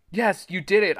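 high, loud cheer; excited cry. A narrator's reading. Boy freed from the blob, excited cry. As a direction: cheer with relief. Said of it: "I cried".

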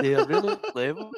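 high, squeezed through laughter; laughing. Real, not acted. Guest cracking up at his own comparison, laughing. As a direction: say it while cracking up.

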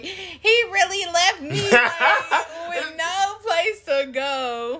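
whiny fake sob, slowed; mock boohoo crying. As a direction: wail it like a self-pitying crier. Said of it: "boohoo crying".

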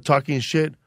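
ordinary delivery

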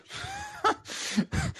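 high, burst of relieved laughter. Real, not acted. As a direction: crack up after the scare. Laughing at being doomed.

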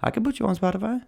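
squeaky fast-talking salesman offer; voicing Anchor. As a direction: play the eager pitchman. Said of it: "He's like".

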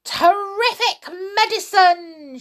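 high, loud exclamation; delighted cry. A narrator's reading. Grandma hails the medicine, delighted cry. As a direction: cry it out with relish. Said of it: "she cried".

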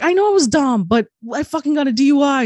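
high-pitched retold pleading; exasperated, high-voiced admission; defensive protest. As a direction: act out owning up in a fluster. Retold defensive reply to brother.

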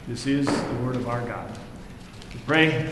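ordinary talk

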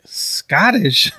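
high, loud mock-doubtful question; incredulous guess. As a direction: ask it as if you can't believe it. Retelling his incredulous guess.

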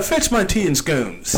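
slow, sophisticated British put-on; hammed-up British, slowly delivered; mock-British accent. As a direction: draw it out in a playful British accent. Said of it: "I put British accent on everything".

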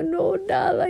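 high, soft mock whimper; imitated tearful voice. Imitating herself crying to the driver, tearful. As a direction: brush it off in a small weepy voice. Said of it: "lloraba, lloraba".

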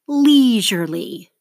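"Leisurely" slowly, LEI loud and long; stress on first syllable. Shown as a demonstration. Teacher models for seventh graders to mark, loud stressed LEI in "Leisurely". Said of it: "circle the stress syllable".

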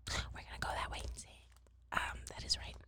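quick soft whisper. Real, not acted. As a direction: breathe the words, no voice. Whispered aside before reading.

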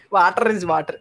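mock Bhojpuri-accented English. Shown as a demonstration. Joking to friends about the slip, in a Bhojpuri accent.

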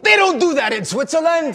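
high, loud, laughing Italian papa; café owner voice. Retold or imitated.

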